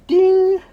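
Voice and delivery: high loud ding; chime sound. Pretend. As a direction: sing out a bright ding. Checking items off list.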